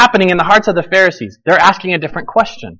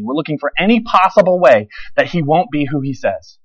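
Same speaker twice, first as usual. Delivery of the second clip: usual speaking voice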